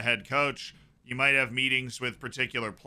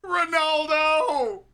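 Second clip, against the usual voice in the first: long, very high drawn-out yell; stadium-style drawn-out shout; ecstatic cry; 5 dB louder, much slower than usual. Real, not acted. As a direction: stretch the name in a huge roar of excitement. Big chance at match, cry.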